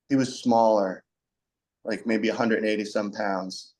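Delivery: ordinary delivery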